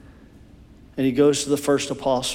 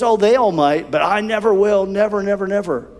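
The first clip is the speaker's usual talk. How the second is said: higher, louder, never repeated and fading; Peter's boastful vow; 5 semitones higher, 3 dB louder than usual. Acted. Peter to Jesus, higher boastful vow fading out. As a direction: swear loyalty over everyone else.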